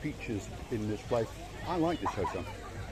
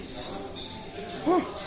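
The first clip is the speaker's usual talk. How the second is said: gasp. Real, not acted. Sudden view of a dessert.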